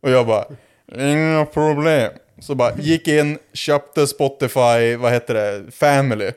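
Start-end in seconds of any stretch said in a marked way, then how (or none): 1.0-2.1 slow, drawn-out imitated reply; grand drunk assurance. Drunk man offers boy help, slow grand assurance. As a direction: stretch it out as no trouble at all.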